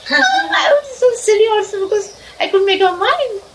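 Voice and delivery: laughing the words out, pitched high; giggling through the line at her own silliness. Real, not acted. Telling the audience how silly she was, laughing.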